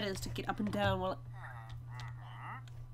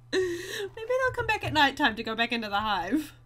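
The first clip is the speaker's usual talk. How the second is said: normal talking voice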